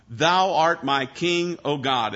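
slow, Thou art my king, art leaned on; declaration of faith. Shown as a demonstration. Preacher declaring the verse to his flock, slow and emphatic. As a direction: proclaim it slowly as a creed. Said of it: "what does he declare".

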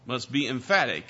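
ordinary talk

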